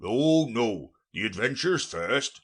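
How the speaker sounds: impatient. A narrator's reading. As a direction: cut in, eager to get on. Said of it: "in an impatient tone".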